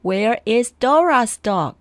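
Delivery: ordinary delivery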